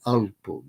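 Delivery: AL-pug, second syllable unstressed; first-syllable stress. Shown as a demonstration. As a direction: say "Alpug" with the accent on AL. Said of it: "put this accent on the first syllable".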